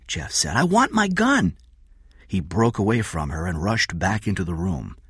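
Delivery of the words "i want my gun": put-on high child's demand; insistent child. Jeff wants his gun, insistent child. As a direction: demand it like a small boy.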